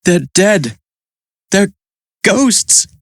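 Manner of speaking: hesitant, with a pause before the last word; a touch of nervousness in a boy's voice; mildly uneasy, halting delivery; nervous. A narrator's reading. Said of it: "says Mike nervously".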